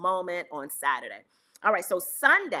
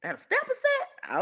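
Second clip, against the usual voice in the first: high disbelieving squeak of a question; mock disbelief at a gospel song; incredulous. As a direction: act floored by the idea, pitch jumping way up. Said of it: "I'm like".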